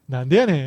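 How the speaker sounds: "Nande ya nen" with the pitch peaking on "ya", then falling; correct Kansai intonation. Shown as a demonstration. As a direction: lift "ya" in "Nande ya nen" and drop away. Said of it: "you gotta get the intonation right".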